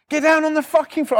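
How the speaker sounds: high-pitched mock shout; shouted police order. Copper with baton out ordering repo man down, shouting. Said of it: "They're all fucking shouting at us".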